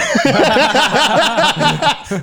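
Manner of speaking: high giggling laugh. Real, not acted. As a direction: laugh at your own joke. Laughing at own joke. (risa aguda)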